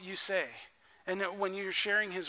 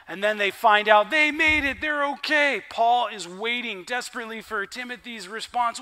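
ordinary delivery

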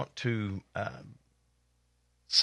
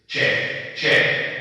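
mic check; 4 dB louder than usual. Real, not acted. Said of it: "I'm gonna give it a test here".

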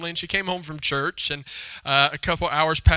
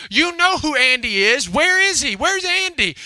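put-on high, louder child's demand, climbing in pitch; insistent little girl; 10 semitones higher, 6 dB louder than usual. Little girl argues with Mama, insistent and high-pitched. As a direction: imitate a frustrated child accusing her mother. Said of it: "she just stormed off from Mama".

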